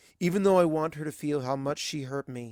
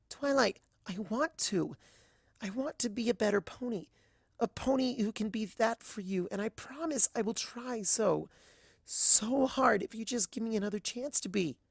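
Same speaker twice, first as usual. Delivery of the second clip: higher, softer pleading mare voice; Azalea pleading; 9 semitones higher, 3 dB quieter than usual. A narrator's reading. Azalea begs Twilight for another chance, soft high pleading. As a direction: beg him gently, voice lifted and hushed.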